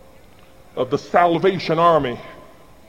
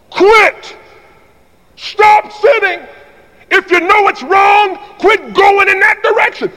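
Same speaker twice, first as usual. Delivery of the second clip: loud, much higher, hammering each word; preaching at full force; 12 semitones higher, 5 dB louder than usual. Real, not acted. Preacher shouting a warning to the congregation, full force. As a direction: preach it hard.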